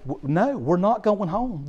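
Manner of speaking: ordinary talk, nothing marked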